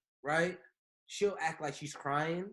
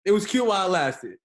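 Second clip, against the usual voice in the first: loud hype; 4 semitones higher, 10 dB louder than usual. Real, not acted. Hyping up the conversation.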